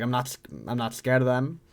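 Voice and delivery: ordinary delivery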